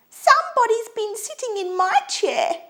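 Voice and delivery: very high little bear squeak; squeaky Baby Bear. A narrator's reading. Baby Bear finds his chair smashed, high squeak. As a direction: squeal it like an upset little cub. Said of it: "he squeaked".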